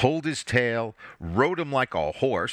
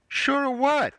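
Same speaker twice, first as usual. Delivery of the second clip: put-on curious little girl. A narrator's reading. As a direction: chirp it like a six-year-old girl. Six-year-old daughter interrupts.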